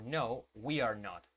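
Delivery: "No, we are not." slowed, falling; slow teacher model; slow drill model. Shown as a demonstration. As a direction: give "No, we are not." slowly. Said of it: "respuestas cortas".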